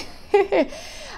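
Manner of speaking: short unvoiced laugh, high; breathy laugh. Real, not acted. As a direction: let a little airy laugh slip. Laughs about sister not tolerating her.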